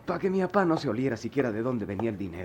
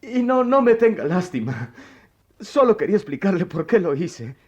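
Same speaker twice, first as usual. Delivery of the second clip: pained confession; 3 semitones higher, 5 dB louder than usual. Acted. Boy confesses theft to teacher.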